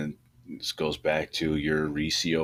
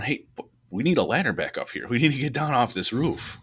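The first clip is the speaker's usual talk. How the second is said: mock yell with raised, swooping pitch; retold yelling down; 7 semitones higher than usual. Firemen calling down for a ladder, mock yelling. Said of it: "they're yelling down".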